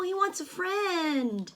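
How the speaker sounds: ordinary delivery, nothing marked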